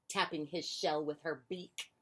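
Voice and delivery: ordinary delivery, nothing marked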